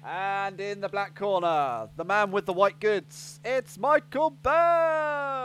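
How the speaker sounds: slow, drawn-out fight night intro; boxing announcer. Pretend. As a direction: call him into the ring like a champ.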